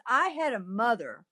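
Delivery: soft, light, amused delivery; laughingly. Acted. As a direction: toss it off softly, as through a laugh. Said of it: "she laughs".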